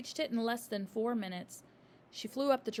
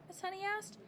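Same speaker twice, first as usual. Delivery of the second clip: plain reading voice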